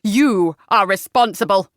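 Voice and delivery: stern, forceful condemnation; accusing. A narrator's reading. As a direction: lay the blame hard. Father accuses daughter.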